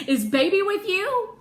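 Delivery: high, loud and eager; eager question. Acted. Piper asks Ree about the lost dog, loud eager question. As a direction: burst out hoping for good news.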